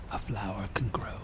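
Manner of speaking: soft. A narrator's reading. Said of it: "said Duroc".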